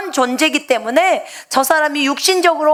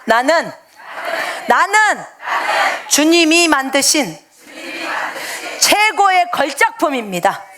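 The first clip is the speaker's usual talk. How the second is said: proud declaration; 4 semitones higher than usual. Shown as a demonstration. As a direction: proclaim it proudly, every word weighted. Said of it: "자, 따라해보세요".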